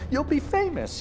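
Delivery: very high mimicry of the boss; mocking quote. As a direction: parrot the empty promise. Underling mimics officer's promise.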